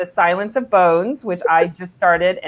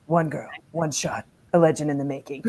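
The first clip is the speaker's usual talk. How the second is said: dramatic movie trailer narrator; lightly voiced nineties trailer announcer; trailer guy voice; 2 semitones lower than usual. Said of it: "the trailer guy voice".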